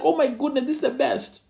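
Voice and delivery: high, thrilled exclaim that fades; a boy overjoyed at coming third; delighted child. Retold or imitated. Boy celebrating coming third in class, high and thrilled. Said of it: "I was like".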